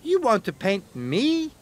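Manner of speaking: can't believe it; lightly voiced stunned Percy; amazed engine. A narrator's reading.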